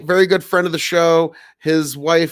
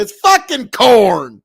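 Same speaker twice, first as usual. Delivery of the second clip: "It's fucking corn" slow, loud and higher; Nebraska drawl; 6 semitones higher, 9 dB louder, slower than usual. Shown as a demonstration. Streamer defending his farm accent, loud drawl. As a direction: spit out "It's fucking corn" like a riled farmer. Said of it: "But when I say".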